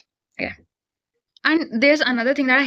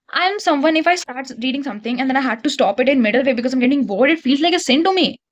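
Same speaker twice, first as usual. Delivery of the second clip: ordinary delivery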